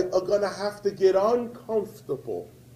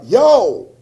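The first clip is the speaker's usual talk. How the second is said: loud mock yo; a buddy hailing him over the phone; friend calling; 4 semitones higher, 11 dB louder than usual. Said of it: "He calls me up".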